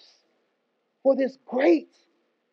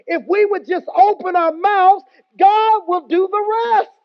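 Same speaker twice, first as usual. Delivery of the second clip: preaching at full force; 4 semitones higher, 4 dB louder than usual. Acted. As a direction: build the congregation up and call for a response.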